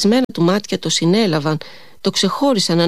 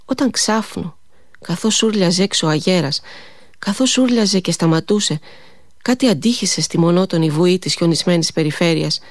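ordinary delivery, nothing marked

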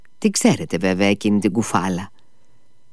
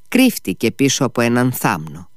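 plain reading voice

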